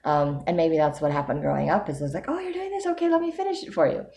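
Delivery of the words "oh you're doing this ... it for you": high, fast, sing-song imitation of an eager helper; mock helpful takeover. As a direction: mimic someone brushing her aside to finish it for her, much higher. Retold others taking over her tasks.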